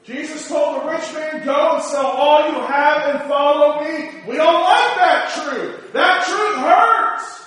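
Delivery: loud high preaching, slowing at end; rising preacher cadence. Acted. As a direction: build it to a loud peak. Preaching at full force.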